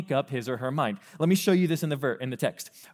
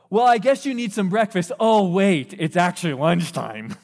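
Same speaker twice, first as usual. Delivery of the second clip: louder, higher mocking singsong; sarcastic parent; 4 semitones higher, 7 dB louder than usual. Parent teasing the late sleeper, loud and mocking. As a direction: rib them with fake surprise. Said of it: "they may say like this".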